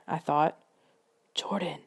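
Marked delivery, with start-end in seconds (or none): 1.3-1.8 hushed whisper of his name. A narrator's reading. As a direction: whisper so nothing hears. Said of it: "Nicole whispered".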